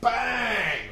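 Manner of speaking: high, loud drawn-out "bang"; punch sound effect. Retold or imitated. Storyteller acting out the punch on his mate, shouted impact. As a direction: shout it out as the blow lands.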